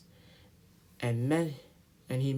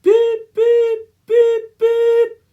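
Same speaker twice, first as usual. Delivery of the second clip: 1 impatient whistle; 16 dB louder, much slower than usual. Acted. Edward whistles for guard.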